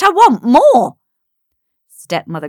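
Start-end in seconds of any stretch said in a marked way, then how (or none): 0.0-1.0 high, loud, greedy shriek; tantrum. Acted. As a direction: shriek like a spoilt brat.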